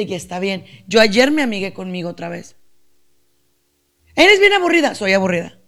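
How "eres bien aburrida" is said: much higher and louder sneering voice; mocking critic. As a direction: jeer at her like a cruel critic. Imagined critic judging her. (crítica burlona, aguda y fuerte)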